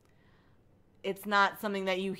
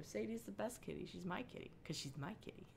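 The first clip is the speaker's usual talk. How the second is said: soft pet talk, much quieter; cooing to cat; 14 dB quieter, faster than usual. Real, not acted. Softly cooing at her cat Sadie on the couch. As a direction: murmur lovingly to the cat nearby.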